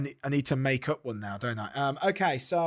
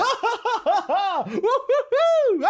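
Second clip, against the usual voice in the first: very high whooping guffaws; inappropriate laughter; 5 dB louder, slower than usual. Acted. Clacker laughing as a man dies, high manic whoops. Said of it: "he's, like, laughing at this guy dying".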